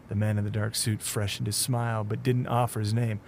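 usual speaking voice

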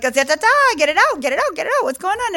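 hammed-up fast-talking Californian; fast California talk. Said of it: "when you're from California".

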